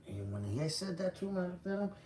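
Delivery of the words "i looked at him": ordinary delivery, nothing marked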